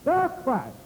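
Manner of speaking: THE lifted high, falling on Christ; preacher's emphatic THE in "The Christ"; stress on THE. Shown as a demonstration. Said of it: "gonna put some emphasis on the word".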